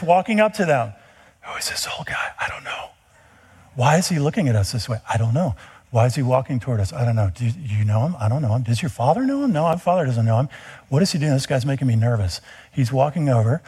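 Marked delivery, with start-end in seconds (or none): 1.4-2.9 hushed, unvoiced whisper between the couple; nervous whisper. Mary and Joseph whispering as a stranger approaches. 3.7-12.4 nervous back and forth. Retold or imitated. Couple anxious as old man approaches.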